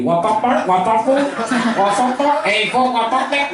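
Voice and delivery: high, honking mock voice; horn-like mock voice. Comedian imitating local guys, high and honking. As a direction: honk the words like a car horn.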